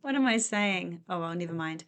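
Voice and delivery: ordinary delivery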